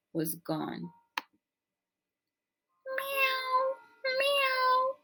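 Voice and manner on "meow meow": high squeaky kitten meow; cat meows. A narrator's reading. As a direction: mew like hungry little cats. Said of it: "said the hundreds of cats".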